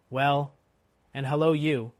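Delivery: loud, lightly hoarse hail back; hoarse reply. A narrator's reading. Jerry answers the guard, hoarse hail. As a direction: throw the greeting back hoarsely. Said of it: "more hoarsely than before".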